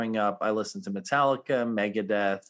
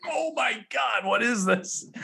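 excited; 13 semitones higher than usual. Retold or imitated. Retelling excited first hearing.

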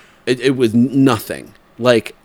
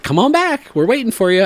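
much higher, louder, chirpy nurse impression; mock-sweet nurse inviting him back much higher; eager nurse; 13 semitones higher, 5 dB louder than usual. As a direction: mimic a cheery nurse waving him in.

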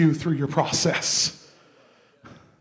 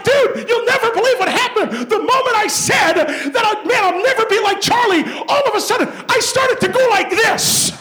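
much higher, loud, laughing through it; high shouted laughing retelling; laughing excited story; 9 dB louder than usual. Retold or imitated. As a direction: tell it through laughter, astonished. Friend laughing, telling miracle.

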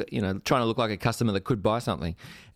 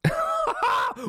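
a high burst of delighted laughter. Real, not acted.

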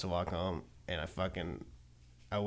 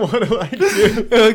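laughing; 9 semitones higher, 7 dB louder, much slower than usual. Retold or imitated. Repeating friend's panicked line.

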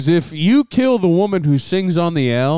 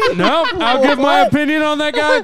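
laughing; 10 semitones higher than usual. Real, not acted. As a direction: push the line out while laughing. Laughing mock defiance.

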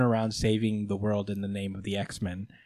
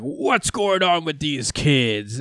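slow, stern authority figure; slow stern hero voice; 4 semitones higher, much slower than usual. Retold or imitated. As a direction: drag it out like a stern boss. Voicing the Avengers.

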